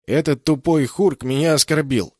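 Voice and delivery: loud, high spiteful complaint; angry. A narrator's reading. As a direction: accuse the prisoner with malice. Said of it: "зло ответил Шонг".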